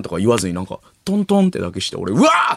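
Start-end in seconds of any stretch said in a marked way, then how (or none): 2.1-2.5 loud, high-pitched startled "whoa"; imitated high yell of shock. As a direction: yelp out loud as if caught off guard. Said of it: "って言ったからな".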